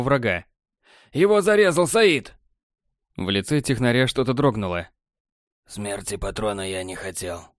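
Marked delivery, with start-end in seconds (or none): 1.1-2.3 bitter accusation. A narrator's reading. Borland telling Technar of the killing, high bitter voice. 5.7-7.4 lower, much softer, cool and smug; cool technician. A narrator's reading. Technar answering Borland, low smug and soft.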